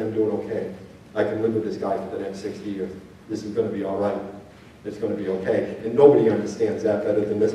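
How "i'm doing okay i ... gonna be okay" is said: low, soft self-reassurance; calm yourself in the mirror; teen looking in the mirror, low and soft with relief; reassured teen. Retold or imitated.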